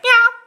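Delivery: high, loud mimicked meow; kitten meow. Showing viewers her toy kitten's pose, playful cat meow. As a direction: mew out like a little kitten.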